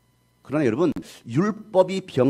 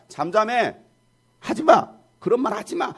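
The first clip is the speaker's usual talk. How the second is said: raised pitch, firm order to hush; acted silencing command; 6 semitones higher than usual. Jesus silencing a demon, urgent high-pitched command. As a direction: cut the speaker off with an urgent order. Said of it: "라고 잠잠하게 하셨습니다".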